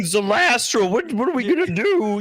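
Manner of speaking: high, put-on bumbling idiot voice; dopey henchman voice. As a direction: squeak it out like a clueless goon. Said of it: "he talks like this".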